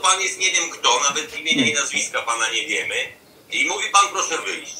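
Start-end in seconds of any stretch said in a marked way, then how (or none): none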